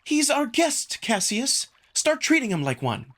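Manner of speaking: scolding sister. Acted. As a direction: scold your brother firmly.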